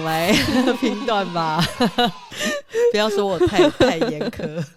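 bursting out laughing mid-sentence; loud laugh breaking through words; laughing loudly. Real, not acted. Laughs while teasing co-host.

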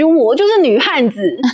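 playful retort. Retold or imitated. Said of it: "我就马上接一句".

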